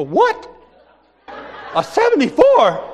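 high, stunned what with a laugh; shocked disbelief. Retold or imitated. Friend reveals a bigger TV.

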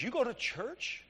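mock surprise, softly rising at the end; incredulous question. Blood technician questioning a churchgoer, imitated incredulity. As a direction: imitate her quiet, drawn-out disbelief. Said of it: "But she asked that question".

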